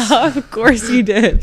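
slow amused repeat, still laughing. Real, not acted. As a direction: drawl it out amused.

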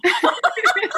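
high, bright burst of real laughter; a delighted giggle. Real, not acted. Host and guest laughing over mutual compliments, real laugh.

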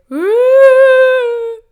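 very high, very loud whooping cry; mock wail. Tom outside the room, very high loud wail. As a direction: let out a huge cry.

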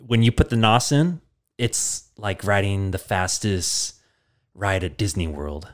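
mock-solemn mentor trailing off; Mark's voice. Mark describes nos to Vin Diesel, voice sinking quiet. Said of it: "Mark goes".

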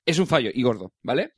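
ordinary delivery

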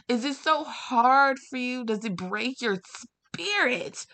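high, pleading outrage, voice climbing; exasperated rant. Real, not acted. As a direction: demand answers, fed up and hurt. Ranting at people who harass them.

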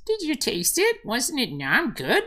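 high, squeaky kitty with long meows; talking cat. Acted. Cat checking in, then saying goodbye, high kitty voice. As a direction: play a little cat, pitch swooping high. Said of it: "then the cat comes wandering back".

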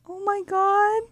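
high squealing oh my god; gushing. Retold or imitated. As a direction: swoon over the movie. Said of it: "I'm like".

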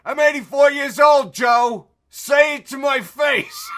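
mock old man shouting high and loud; old dad's challenge. Rogan's dad challenging Joe in a loud, high mock shout. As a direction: ham up a feisty old dad daring his son. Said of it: "he's like".

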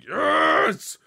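high, loud sports-fan cheer; retold burst of cheering; cheering yell. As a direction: yell like the home team just scored. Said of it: "I'm just down there like".

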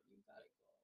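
soft whispered breakup line; gently whispered. Shown as a demonstration. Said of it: "I'll say that gently".

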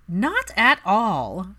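slow, drawn-out reassurance; slow reassuring not at all. Acted. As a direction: brush the worry away gently. Daphne waving worries away.

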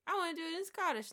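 excited mock pitch for a Scottish accent; mock Mike Myers. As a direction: play Myers pitching his idea, high and fast. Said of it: "And then he was like".

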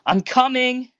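high, loud call toward the door. A narrator's reading. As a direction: holler over the noise, pitched right up. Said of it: "called a little louder".

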